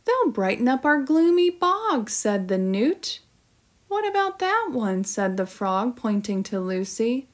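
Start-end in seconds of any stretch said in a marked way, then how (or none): 0.0-2.1 lightly voiced, high-pitched newt; newt character voice. A narrator's reading. 3.9-5.0 quick, high-pitched little frog; frog character voice. A narrator's reading.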